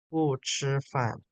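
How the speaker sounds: 不吃饭 stretched very slowly; teacher models 不吃饭 syllable by syllable; slow model phrase. Shown as a demonstration. Teacher repeats the student's answer, very slowly. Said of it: "Ah, 为什么".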